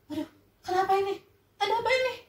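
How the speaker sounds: high, fast, frightened cry; panicked Malin. Acted. Son turning to stone, high frightened cry. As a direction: panic as your body stiffens.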